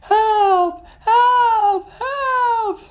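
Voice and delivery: very high, squealed cries for rescue; screaming. Acted. Pirate fleeing a shark fin, high screaming. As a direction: shriek each cry separately. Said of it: "she started to scream".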